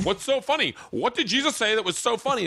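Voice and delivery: high, sharp mock teacher demand; teacher demanding to know the joke; annoyed teacher. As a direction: scold the laughing student. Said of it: "and he was".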